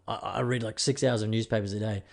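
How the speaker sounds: ordinary delivery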